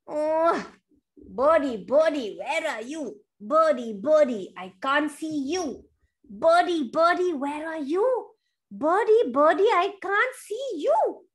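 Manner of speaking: sing-song calls for a lost friend; lightly acted bear on the hunt; searching bear calling. Bear calls for hidden Birdie.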